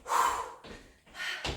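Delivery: sharp breath out through the lips; blowing out hard. Real, not acted. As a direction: blow out hard on the effort. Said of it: "Tu m'entends souffler?".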